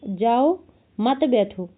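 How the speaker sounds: "जाओ", pause, then "मत बैठो" together; pause after जाओ. Shown as a demonstration. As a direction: set "जाओ" apart from "मत बैठो". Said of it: "उसने सुन क्या लिया".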